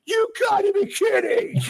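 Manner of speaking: hammed-up high shout; mock American shout.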